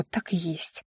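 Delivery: ordinary delivery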